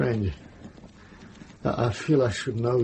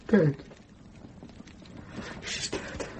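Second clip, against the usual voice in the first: grief-stricken; 6 semitones higher, 7 dB quieter, slower than usual. Acted. Learns Hilaria is dead.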